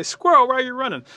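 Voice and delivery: high, very loud shouted question; rabbit calling out. Acted. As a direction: holler it after someone running. Rabbit calls out to squirrel.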